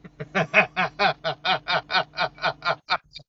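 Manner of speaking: real laugh at his own joke; amused laugh. Real, not acted. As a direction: burst out laughing.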